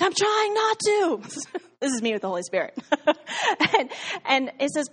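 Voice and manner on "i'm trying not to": exasperated retort. Retold or imitated. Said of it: "And I'm like".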